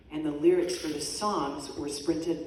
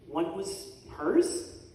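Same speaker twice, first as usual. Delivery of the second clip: ordinary talk, nothing marked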